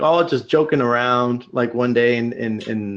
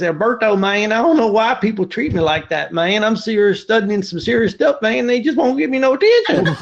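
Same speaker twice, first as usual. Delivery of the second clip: imitating a West Virginia accent; 6 semitones higher than usual. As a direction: play the hassled West Virginia student in a light West Virginia accent. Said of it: "really heavy, heavy accent".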